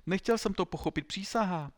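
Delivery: high, loud desperate oath; pleading cry. A narrator's reading. Pupil begging to keep his cockade, pleading.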